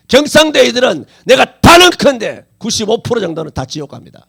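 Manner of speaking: loud, emphatic pulpit denunciation; forceful preaching. Real, not acted. Preacher condemning a region's unbelievers to the congregation, loud and forceful.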